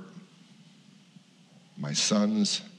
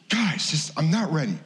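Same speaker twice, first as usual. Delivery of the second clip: higher, mock exasperated plea; pleading; 8 semitones higher than usual. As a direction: fend them off, half-laughing. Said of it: "And I'm going".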